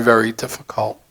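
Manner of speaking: ordinary delivery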